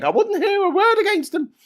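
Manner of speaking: high, loud mock falsetto; voice from the grave. Murdered gangster fan defending killers from the grave, shrill parody. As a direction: squawk loyally from beyond the grave. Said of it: "you can hear from the grave someone saying".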